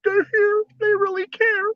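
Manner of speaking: high crying wrestler persona; high falsetto mock tears; in character. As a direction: squeal it out, overcome, staying in character. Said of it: "I tried to stay in the character".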